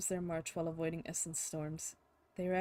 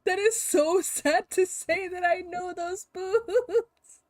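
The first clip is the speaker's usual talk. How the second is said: slow, much higher, loud mock wail; mock lament; 11 semitones higher, 11 dB louder, much slower than usual. Real, not acted. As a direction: ham up the sorrow, slow and high. Exaggerated lament over boots.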